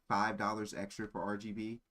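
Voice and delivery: ordinary talk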